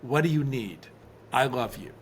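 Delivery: low, measured, tender reassurance; soothing repetition. Retold or imitated. Husband calming his triggered wife late at night, soothing and low. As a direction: gently repeat it, dropping at each end. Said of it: "repeating to her over and over again".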